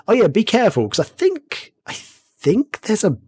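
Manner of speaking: high, slow, teasing "be careful" warning; mock cautious warning. Telling a friend at his desk there's a bear nearby, slow high teasing warning. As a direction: spook your friend with a slow, sing-song hint.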